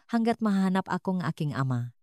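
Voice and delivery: plain reading voice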